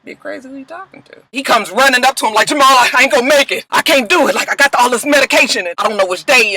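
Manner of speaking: high, frantic pleading; panicked brother. Retold or imitated. Poe begging his brother for help, high and frantic.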